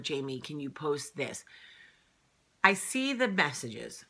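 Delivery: ordinary delivery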